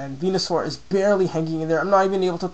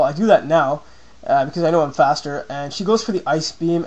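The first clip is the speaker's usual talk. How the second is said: ordinary talk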